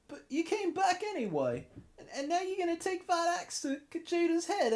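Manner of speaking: pleading servant voice. Acted. Voicing a grateful follower to his lord, squeaky pleading voice. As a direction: beg your lord with a squeaky, eager cry.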